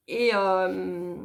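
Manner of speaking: usual speaking voice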